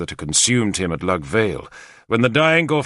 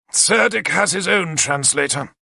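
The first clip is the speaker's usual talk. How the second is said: dismissive; 7 semitones higher than usual. Acted. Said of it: "he said dismissively".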